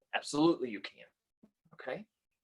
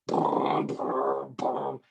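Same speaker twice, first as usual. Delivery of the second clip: rumbling "boom, boom, boom" like car bass; imitated subwoofer boom; much slower than usual. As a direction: mimic a thumping car subwoofer.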